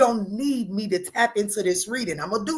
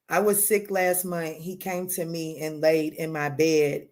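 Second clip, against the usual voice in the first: ordinary talk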